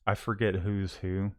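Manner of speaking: normal talking voice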